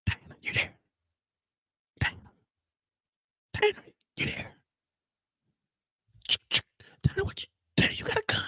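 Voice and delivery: desperate hushed whisper. Retold or imitated. Drew whispering into the phone for Diana. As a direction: whisper her name down the phone. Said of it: "who's in there whispering".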